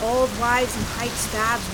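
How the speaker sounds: loud, drawn-out cry of shock. A narrator's reading. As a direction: cry out slowly over the downpour. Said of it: "William cried out in shock".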